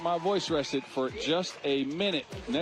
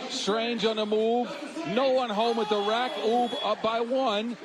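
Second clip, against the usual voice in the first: ordinary talk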